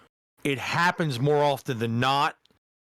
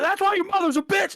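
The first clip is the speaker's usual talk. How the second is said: much higher, blurted comedian-bit voice; mock panic insult. Retelling a comedian's joke to cohost, hammed-up panicked jab. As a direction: fire it off like a cornered man's last shot.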